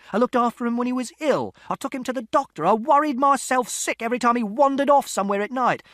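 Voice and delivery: starts plain, climbs to very high, loud rage; building furious shout. A narrator's reading. Father listing what he did for his son, building shout. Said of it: "And Father shouted".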